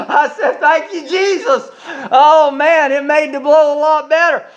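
very high voice through laughter; laughing. Real, not acted. Preacher thanking Jesus for the bad news, laughing. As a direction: squeeze the words out high while laughing.

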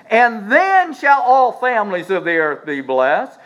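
each then leaned on, slow and loud; stress on then. Shown as a demonstration. Preacher reading Genesis to the church, stressing every then. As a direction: hammer every then in the verse. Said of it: "I'm adding the thens".

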